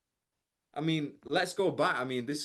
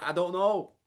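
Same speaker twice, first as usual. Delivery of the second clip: put-on sulky child; 5 semitones higher, 4 dB louder than usual. Child answers parent.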